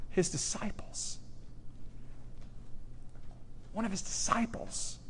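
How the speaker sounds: barely voiced, dramatic hush; hushed reveal. Real, not acted. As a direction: drop to a stunned near-whisper.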